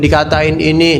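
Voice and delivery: ordinary talk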